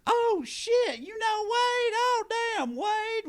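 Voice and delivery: mock excited shout. Friends hamming up their reaction to hearing Wade's name, in a falsetto shout. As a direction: squeal it like you can't believe she knows him.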